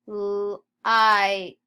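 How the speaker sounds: L-I-T sound by sound, long loud I. Shown as a demonstration. Teacher modelling phonics for the class, sounded out. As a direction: split L-I-T into separate sounds, T detached.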